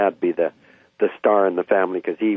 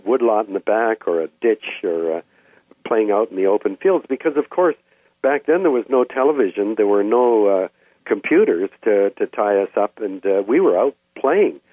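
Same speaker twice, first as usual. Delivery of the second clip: ordinary talk, nothing marked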